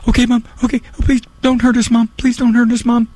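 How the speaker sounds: put-on high, trembly pleading of scared children; hammed-up terrified begging to mom in a small high voice; mock frightened kids. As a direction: plead like petrified little girls, exaggerated. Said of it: "shivering with fright. They weren't scared".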